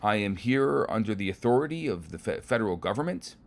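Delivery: indignant outburst. Acted. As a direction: pull rank furiously. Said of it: "that sets the inspector off".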